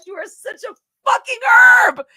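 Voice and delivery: high, loud exasperated yell; real exasperated shout; outraged shout. Real, not acted. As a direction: shout the insult in disbelief. Insulting the friend in outrage.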